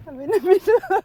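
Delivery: high, loud flustered cry; flustered exclamation. Real, not acted. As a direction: blurt it out in a panic. Panicking mid-manoeuvre, laughing.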